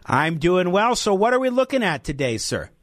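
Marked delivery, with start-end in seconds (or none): none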